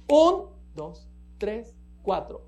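strong downbeat count; accent on one. Shown as a demonstration. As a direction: punch the first beat, let the rest fall away. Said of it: "el uno es acentuado".

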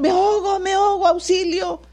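high-pitched mock drowning cry; high, urgent imitation of someone crying out; acted cry for help. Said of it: "hasta gritan".

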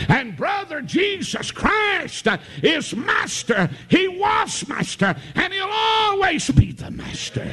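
high, shouted, drawn-out preacher's cry; preaching at full force. Acted. Preacher exalting Jesus to the congregation, full-force preaching. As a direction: lift it up and ring it out to the church.

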